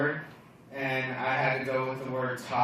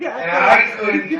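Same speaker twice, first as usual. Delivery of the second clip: normal talking voice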